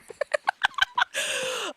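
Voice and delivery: squealing high laugh; shrill laughing burst. Real, not acted. Laughing at own rant.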